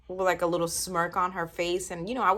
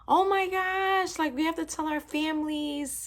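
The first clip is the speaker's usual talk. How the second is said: stunned excitement; 11 semitones higher than usual. Retold or imitated. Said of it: "We were just like".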